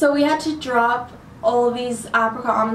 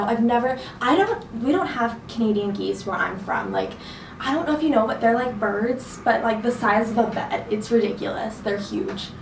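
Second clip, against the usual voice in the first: normal talking voice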